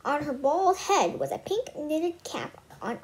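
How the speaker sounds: ordinary delivery, nothing marked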